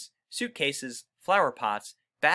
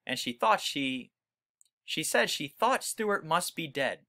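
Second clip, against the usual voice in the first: plain reading voice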